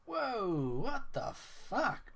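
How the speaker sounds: high, slow incredulous cry; disbelief. Real, not acted. Reacting to the developer's rude tweet, slow high disbelief. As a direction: let the disbelief stretch up high.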